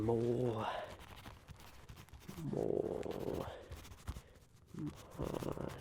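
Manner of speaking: slow, fading words muttered while writing; murmured dictation. Acted. The Onceler jotting the little bear's request, quiet and slow. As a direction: trail off as you note it down.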